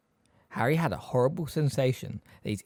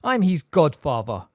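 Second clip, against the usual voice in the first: loud, slow, higher assertion; 5 semitones higher, 9 dB louder, slower than usual. A narrator's reading. Sirius insists on staying with Harry, very loudly. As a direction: assert the claim forcefully. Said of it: "said Sirius louder than ever".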